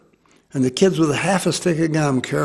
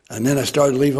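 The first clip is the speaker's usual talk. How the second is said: ordinary talk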